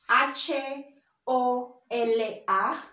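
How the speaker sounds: H O L A spelled as "hache, o, ele, a"; Spanish letter names. Shown as a demonstration. Teacher spelling a greeting for learners, Spanish letter names. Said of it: "our H is pronounced as".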